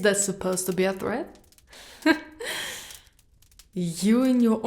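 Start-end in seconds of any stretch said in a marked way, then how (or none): 2.0-2.9 high, scornful dragon laugh; mocking laugh. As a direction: laugh off the threat with contempt. Dragon mocks the threat.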